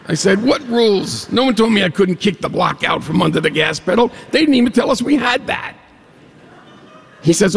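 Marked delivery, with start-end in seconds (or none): 0.4-5.7 indignant protest. Retold or imitated. Arguing with Jake over rules.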